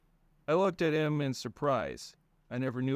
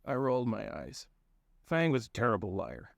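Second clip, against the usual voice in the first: ordinary delivery, nothing marked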